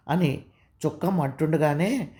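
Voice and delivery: plain reading voice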